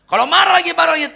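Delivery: sudden loud peak; rising outburst. Acted. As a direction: burst out, then settle. Mocking angry wife escalating.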